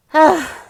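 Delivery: big, loud exhaled sigh. Real, not acted. Podcaster wrapping up her story, loud sigh. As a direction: let out a heavy sigh.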